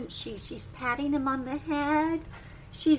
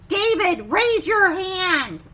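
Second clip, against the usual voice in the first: loud, slow command; firm classroom order; teacher telling David to raise his hand; stern order; 4 semitones higher, 8 dB louder, slower than usual. Acted. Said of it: "There's that another one".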